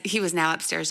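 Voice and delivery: usual speaking voice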